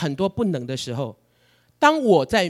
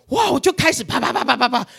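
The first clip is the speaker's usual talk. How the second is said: mock rapid ranting; 6 semitones higher, 3 dB louder than usual.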